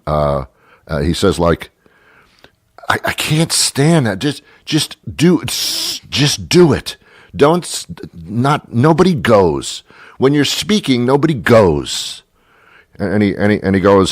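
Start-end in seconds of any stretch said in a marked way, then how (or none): 2.9-12.2 higher-pitched, halting exasperation; exasperated rant. Acted. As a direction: lecture a maddening talker, barely holding on. Rants at the storyteller's grammar.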